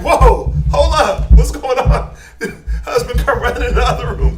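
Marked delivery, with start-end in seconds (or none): 0.0-2.1 much louder, higher, laughing alarm; startled exclaim. Acted. Onlooker startled by the yelling mother, laughing alarm. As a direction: pull back in shock, half laughing.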